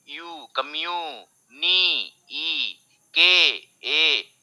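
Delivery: slow, separate sounds from "कम्यू" to "के"; broken into syllables. Shown as a demonstration. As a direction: stretch each vowel in "नी, ई, के, ए". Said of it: "तो यहाँ पे break हो गया".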